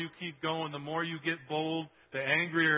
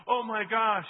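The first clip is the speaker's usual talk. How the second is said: high, loud mock amazement; playing a starstruck shopper, loud and high; mock awe; 7 semitones higher, 10 dB louder, slower than usual. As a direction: gush in fake astonishment. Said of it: "How many of you guys are gonna be like".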